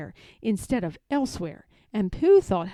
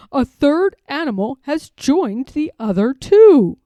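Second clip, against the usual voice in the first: louder, excited bear voice; excited Pooh; 3 semitones higher, 14 dB louder than usual. A narrator's reading. Pooh announces a third animal, loud and excited. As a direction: announce the discovery.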